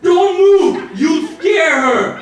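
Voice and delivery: loud, high acted warning, drawn out at the end; mock-urgent shouted order; friend's warning shout. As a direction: yell an urgent warning, hammed up. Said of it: "My friend says".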